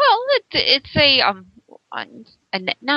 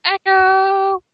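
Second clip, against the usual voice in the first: echo call; 11 semitones higher, 7 dB louder than usual. Pretend. Mimicking the echo on the line.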